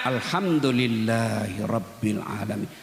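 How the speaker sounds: low, slow and drawn-out praise; lingering low thanksgiving; slow, grateful recitation. Shown as a demonstration. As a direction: savour every word with a full heart. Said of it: "Coba ucapkan dengan lapang dada".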